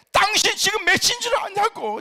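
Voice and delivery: high, loud furious yell; angry shout. Retold or imitated. Husband yelling at his wife as she walks in late. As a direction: blast it at her as she walks in.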